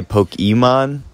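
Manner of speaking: Pokemon with an EE in the middle; said as POKE-ee-mon. Shown as a demonstration. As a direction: stretch out "Pokemon" as poh-kee-mon. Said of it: "Do you say".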